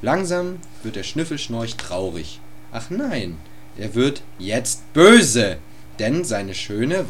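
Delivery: normal talking voice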